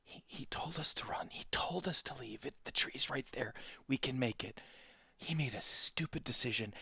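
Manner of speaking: urgent, insinuating stage whisper; whispering inner beast. Acted. Pixie's beast urges her to abandon Sal, urgent whisper. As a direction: whisper temptation right into the ear. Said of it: "whispers in your ear".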